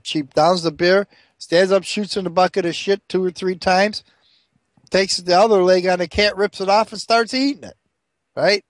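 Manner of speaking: normal talking voice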